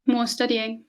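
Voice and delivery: usual speaking voice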